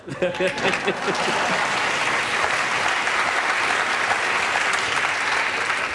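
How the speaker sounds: loud real laughter; long hearty laugh. Real, not acted. Laughs at own joke.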